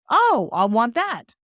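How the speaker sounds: acted delighted interest; eager customer. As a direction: draw it out, sold on the spot. Voicing an eager fitness customer.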